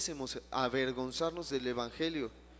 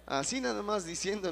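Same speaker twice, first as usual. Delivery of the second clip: ordinary talk, nothing marked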